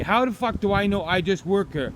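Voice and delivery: usual speaking voice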